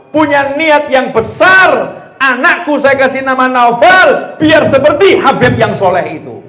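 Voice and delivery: loud, high preaching peak; fiery preaching. Acted. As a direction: proclaim it to the whole hall. Preaching at full force.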